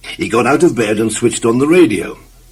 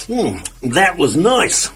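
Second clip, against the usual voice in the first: contented; 7 semitones higher, slower than usual. Acted. Satisfied after supper.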